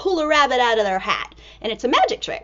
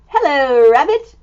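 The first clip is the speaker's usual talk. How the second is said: cheery hello; 8 dB louder than usual. Acted. Greeting the rabbit.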